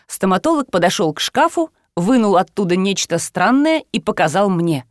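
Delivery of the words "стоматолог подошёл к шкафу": ordinary delivery, nothing marked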